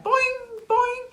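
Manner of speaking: very high springy boing; bouncy sound effect. Acted. Storyteller imitating an egg bouncing for listeners, very high and springy. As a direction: sing out a cartoon bounce, high.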